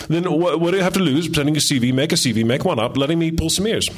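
put-on breezy Australian accent, talking up the job. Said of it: "that's my Australian accent".

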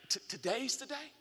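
soft, high, breathless question; hushed hopeful child. Retold or imitated. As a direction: barely dare to ask.

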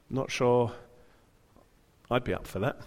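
ordinary delivery

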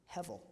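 hevel said HEV-el, rhyming with level; hevel, two light syllables; Hebrew word hevel. Shown as a demonstration. Preacher teaching the congregation the Hebrew hevel, carefully pronounced. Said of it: "it rhymes with level".

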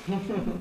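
soft short chuckle; quiet little laugh; amused laugh. Real, not acted. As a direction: chuckle briefly under the breath. Amused at matchmakers fainting.

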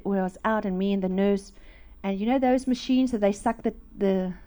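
usual speaking voice

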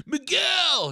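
high excited yell of a name; excited shout. Real, not acted. Fan hailing the Cobra Kai kid, excited high shout.

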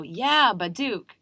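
punchy Israeli-style Yeah, baduk; Israeli accent. Shown as a demonstration. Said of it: "But if I go".

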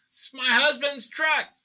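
loud, high, slow Southern indignation; Southern accent. Retold or imitated. Truck owner protests to the painter, indignant Southern accent. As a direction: shriek it slowly in a mock Southern accent. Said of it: "she has a southern accent".

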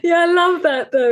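laughing agreement. Real, not acted. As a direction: agree while still laughing. Laughing along with co-host.

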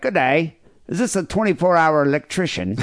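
a lightly attempted Aussie caller; Australian accent. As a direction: killer phoning an electrician, weak Australian accent. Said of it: "picture this in an Australian accent, which I cannot do".

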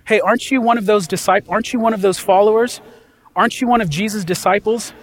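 high, quick needling questions; mock accusers questioning Peter; accusing bystander. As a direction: press him like a suspicious crowd.

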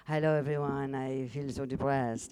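put-on gloomy Russian accent, drawn out. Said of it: "improvisation of being a Russian woman".

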